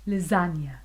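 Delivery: ordinary delivery, nothing marked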